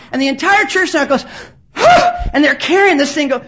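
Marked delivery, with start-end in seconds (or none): 1.7-2.3 loud, high mock gasp; imitated collective gasp of shock. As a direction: gasp like a startled congregation. Whole church reacts in shock.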